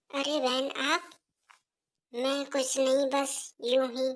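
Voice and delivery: put-on soft, timid young wife offering tea; softly offering tea to her mother-in-law; meek daughter-in-law. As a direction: hand over the tea quietly and politely. Daughter-in-law hides tears.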